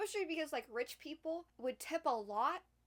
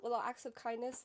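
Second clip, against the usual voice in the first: usual speaking voice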